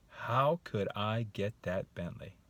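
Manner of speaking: slow, musing question; open wondering. Shown as a demonstration. Man considering the Bentley, wondering. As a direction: turn it over thoughtfully, wanting an answer. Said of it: "I just said the same exact sentence".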